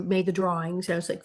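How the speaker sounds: ordinary talk, nothing marked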